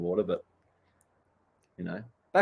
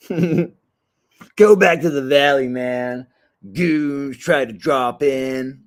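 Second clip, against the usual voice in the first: drawn-out, louder surf-movie heckle; movie quote impression; 4 semitones higher, 5 dB louder, much slower than usual. Surfer quoting Point Break at drop-in goons, hammed-up heckle. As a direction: slowly stretch each word like a movie surfer.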